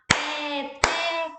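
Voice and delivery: "Peteca" split evenly into pe... te... ca; syllable by syllable. Shown as a demonstration. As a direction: chant "Peteca" one syllable at a time. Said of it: "Três sílabas".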